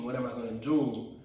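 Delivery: normal talking voice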